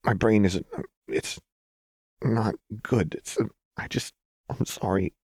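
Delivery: halting, strained apology; tight, muted. A narrator's reading. Jinx struggling to explain herself to Lux, muted. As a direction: falter between fragments, holding it in. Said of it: "Jinx's voice was tight and muted".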